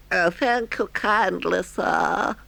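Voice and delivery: high, slow feigned feeble washerwoman. A narrator's reading. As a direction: play the poor weak patient in a high voice. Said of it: "said Toad in a feeble voice".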